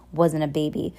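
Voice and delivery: usual speaking voice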